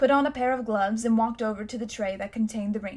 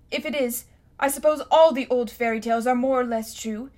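put-on louder, determined boy's warning; defiant boy; 5 dB louder than usual. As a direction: stand up to the wicked uncle. Boy voiced in the story.

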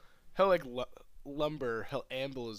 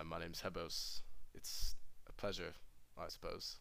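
low, slow and soft; quiet, grudging politeness drawn out slowly; reluctant Hebos; 5 semitones lower, 8 dB quieter, slower than usual. Acted. Hebos, unwilling to talk, greets a new acquaintance, low and reluctant.

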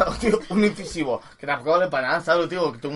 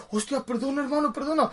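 apologetic plea; 9 semitones higher, 4 dB quieter than usual. Retold or imitated. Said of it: "y yo".

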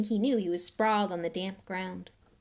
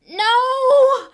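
sudden high, loud yell; 16 dB louder than usual. Acted. Ron yelling as the car dives at the wall. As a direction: scream it as the wall rushes up. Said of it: "Ron yelled".

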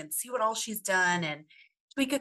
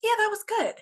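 higher, lukewarm mock shrug; unimpressed; 14 semitones higher, 3 dB louder than usual. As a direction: toss it off like it was just okay. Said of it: "not, not like".

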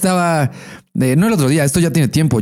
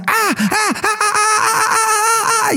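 very high, hammed-up moans breaking into laughter; mock moaning; much slower than usual. Mimicking the downstairs neighbor for his cohost, hammed-up high moans. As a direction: imitate the neighbor's cries, laughing through it. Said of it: "Porque empieza".

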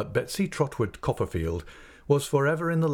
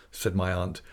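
plain reading voice

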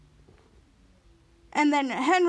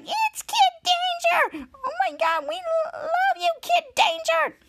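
very high, drawn-out adoring squeal; fangirl squeal; slower than usual. Retold or imitated. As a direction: scream for your idol. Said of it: "Piper's like".

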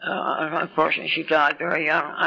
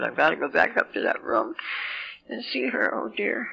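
mild fretful dread; 2 semitones higher, 4 dB quieter than usual. Retold or imitated. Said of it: "and I said".

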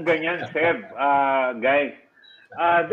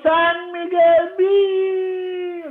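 long, high drawn-out beer ad yell; commercial shout; 10 semitones higher, much slower than usual. Shown as a demonstration. Dubbing actor recreating the San Miguel Beer shout, a drawn-out high yell. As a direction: belt out the brand name like a commercial tag. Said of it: "pati yung pagsigaw".

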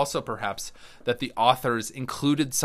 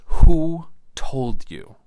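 slow, much louder voice of God; thunderous divine question; booming God voice; 3 semitones higher, 12 dB louder, much slower than usual. Acted. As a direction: boom it out slowly like a stern God. God confronts the hiding man.

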